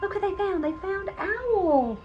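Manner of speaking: plain reading voice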